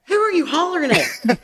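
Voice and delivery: high-pitched mock bafflement; retold incredulous challenge. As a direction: act out calling a friend out. Retold challenge to a mistaken holler.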